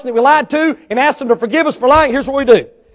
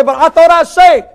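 higher, louder put-on protest; surprised churchgoer; 7 semitones higher, 7 dB louder than usual. Churchgoer objecting to the preacher, higher and louder.

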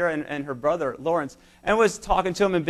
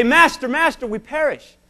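frightened cry; 7 semitones higher, 4 dB louder, slower than usual. Retold or imitated. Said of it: "they yell at Christ".

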